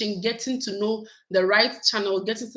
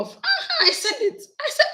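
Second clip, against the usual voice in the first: delighted laugh. Real, not acted. Recognizing an old subscriber name.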